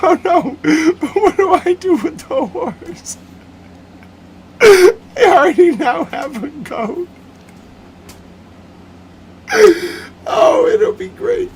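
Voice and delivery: very high, slow, tearful laughter; laughing hard. Real, not acted. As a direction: cry with laughter through the words. Laughing through goat scenario.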